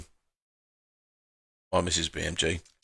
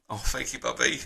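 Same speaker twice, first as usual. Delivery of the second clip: softer, moved little thank you; tender reply to his wife; touched thanks; 5 dB quieter than usual. Real, not acted. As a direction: thank her gently, choked up. Touched by wife's message.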